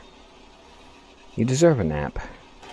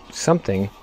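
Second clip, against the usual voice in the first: ordinary delivery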